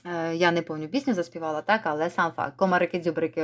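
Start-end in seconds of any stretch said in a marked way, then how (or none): 2.6-3.4 «Комарики-дзюбрики» rattled off quickly; fast tongue twister. Shown as a demonstration. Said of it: "ось так от швидесенько вимовіть". (швидко вимовляє «Комарики-дзюбрики»)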